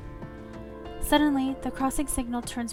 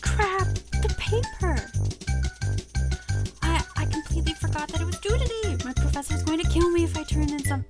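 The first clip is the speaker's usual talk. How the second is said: high, frantic acted panic; sudden alarm at a forgotten deadline; panicky, raised-pitch outburst; panicked; 5 semitones higher than usual. Panics over forgotten paper.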